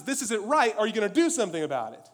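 high, loud protesting plea; appeal to God. Retold or imitated. Righteous believer to God, loud high protest. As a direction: demand an answer from God. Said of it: "Righteous anger appeals to God and says".

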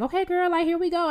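high, breezy mock invitation; high-voiced imitation of an overfamiliar woman; imitated pushy stranger. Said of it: "she's like".

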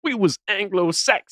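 high-pitched mock American accent. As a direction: parody the clip in an American accent. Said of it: "I'm doing an American accent".

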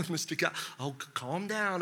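a doctor laughing, telling him to calm down; doctor chuckling. Retold or imitated. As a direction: chuckle it out gently to the patient.

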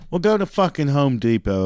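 ordinary talk

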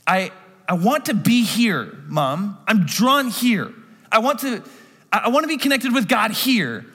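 lightly voiced earnest young Jesus; Jesus pleading. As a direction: tell mom you need to be here. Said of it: "he's also saying".

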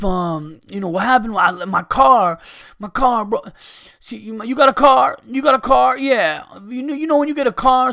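loud, high rambling panhandler; rambling stranger impression. Imitating homeless guy rambling.

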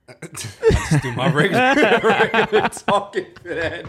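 laughing through the words. Real, not acted. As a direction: breaks into laughter mid-sentence. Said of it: "laughs while answering".

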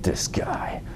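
whispered through gritted teeth; grudging whisper. Retold or imitated. Quietly seething as the pastor greets him, whispered. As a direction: hiss it under your breath with resentment.

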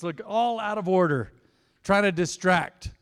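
ordinary delivery

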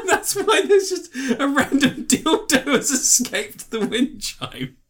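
high, slow, shaking with laughter; laughing through words. Real, not acted. To her co-host, still laughing at the dildo story. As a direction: giggle the words out.

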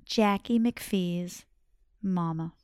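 soft slow whisper; hushed suspense. A narrator's reading. Teller builds suspense to children, whispered. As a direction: hush it like a secret.

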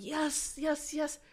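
quick, high, eager yeses; rising hopeful excitement. Retold or imitated. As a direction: cheer him on in your head. Eager inner thought, hoping he's convinced.

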